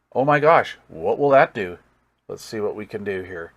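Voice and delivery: ordinary talk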